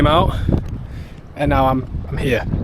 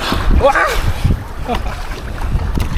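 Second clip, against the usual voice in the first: high laugh; 13 semitones higher than usual. Real, not acted. Wobbling onto the board.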